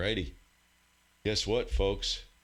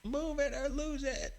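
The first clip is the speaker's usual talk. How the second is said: much higher, silly cartoon squeak; squeaky mock voice; 3 dB quieter than usual. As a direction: repeat it as a joke in a high squeaky voice. Repeats the urging in a put-on voice.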